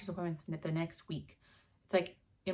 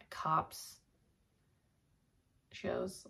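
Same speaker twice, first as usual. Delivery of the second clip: whispered aside; 6 dB quieter than usual. Real, not acted. Sheepish confession of liking cop shows.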